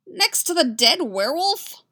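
loud, high-pitched disbelief; shrill, panicky protest with a swooping rise. A narrator's reading. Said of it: "a little shrill".

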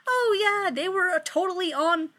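sudden remembering. Acted. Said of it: "until he remembers that".

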